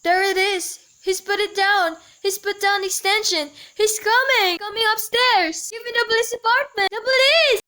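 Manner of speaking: frantic call for help, climbing to a scream; mounting panic. Acted. Intruder is coming upstairs, panic.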